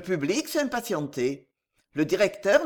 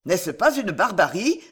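plain reading voice

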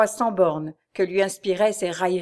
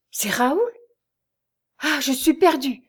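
alarmed question rising into a higher cry of despair; frightened; 6 semitones higher, 3 dB louder than usual. A narrator's reading. Prisoner realises her husband is her captor, frightened and despairing. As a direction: realise with dread who it is. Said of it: "avec un indicible effroi".

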